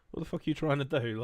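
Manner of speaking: ordinary delivery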